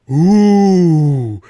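loud, long rising-falling crowd ooh; drawn-out, loud collective ooh; audience "ooh". A narrator's reading. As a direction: let out a big, high "ooh" like an impressed audience. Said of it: "went the audience now".

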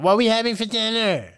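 high, loud mock demand. Donald to his host on the first night, demanding. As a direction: imitate a pushy house guest, pitched right up. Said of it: "very demanding".

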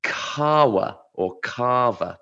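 kahwa or kava, drawn out slowly; Arabic pronunciation. Shown as a demonstration. Pronouncing the Arabic word for coffee, slow and careful. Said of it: "the Arabic word".

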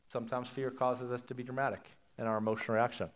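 normal talking voice

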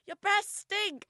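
high, drawn-out blurt at full volume; loud mum impression. Imitating unfiltered mum on the tube, slow loud blurt. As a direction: announce it loudly with no filter. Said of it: "she's so loud".

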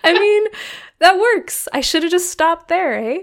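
high, loud laughing reply. Real, not acted. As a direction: laugh through the words. Joking after co-host's teasing.

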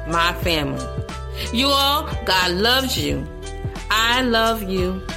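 usual speaking voice

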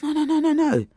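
high, run-together string of refusals; reassuring protest. Retold or imitated. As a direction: imitate a doctor waving away an apology. Doctor hushing her apology.

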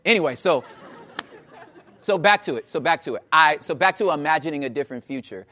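ordinary delivery

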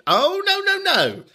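very high, singsong denial; theatrical high-pitched objection; mock correcting. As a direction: swoop up high to scold playfully. Mock correction of imagined Americans.

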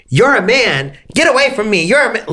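imitating her yelling accusations; mock angry yell. Retold quote of attacker shouting.

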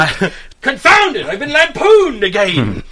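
high, slow, theatrical lament; hammed-up cry of comic indignation; mock outrage. Mock victim of lampooning.

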